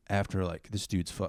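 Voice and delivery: ordinary delivery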